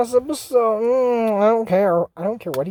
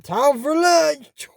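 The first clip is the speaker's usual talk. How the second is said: called out; 6 semitones higher, much slower than usual. Acted. Calls out mockingly.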